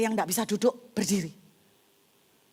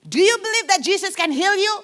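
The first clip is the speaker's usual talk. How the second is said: higher, louder evangelist in English; preacher's question; 10 semitones higher, 7 dB louder than usual. Retold or imitated. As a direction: challenge her like a bold healer. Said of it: "Dia bilang sama wanita ini". (pengkhotbah bertanya lantang)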